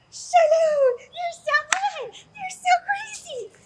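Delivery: put-on very high, loud, drawn-out baby talk; gushing, stretched-out pet hype; amping up the dog. Shown as a demonstration. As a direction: squeal praise to rile up a dog. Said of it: "get him super amped up".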